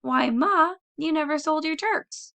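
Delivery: high, loud astonished outburst; astonished cry. Acted. Tom sees his mother bring the turkeys home, astonished high cry. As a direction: gasp it out, open-mouthed. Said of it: "open-mouthed with astonishment".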